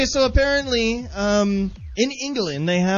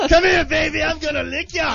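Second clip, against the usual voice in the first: mock creepy shout; 5 semitones higher than usual. Voicing a creepy baby-chaser.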